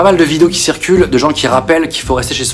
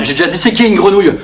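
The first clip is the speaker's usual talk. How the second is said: ordinary talk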